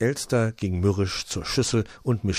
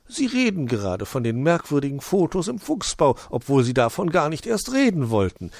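higher, patiently explaining owl; lightly voiced owl, patient; 7 semitones higher, 4 dB louder than usual. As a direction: spell it out kindly. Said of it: "erklärte Onkel Uhu geduldig".

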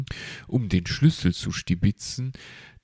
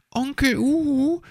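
put-on eager child; 3 dB louder, faster than usual. The hedgehog child Borstel asks.

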